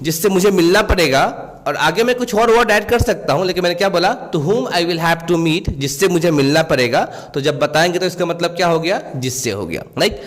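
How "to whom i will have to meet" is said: each word spaced out slowly for learners; slow example reading. Shown as a demonstration. Teacher reading an example sentence to students, slowly. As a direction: dictate it slowly and clearly. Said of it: "teacher reads English example".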